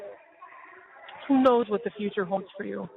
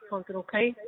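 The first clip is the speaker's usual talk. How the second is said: ordinary delivery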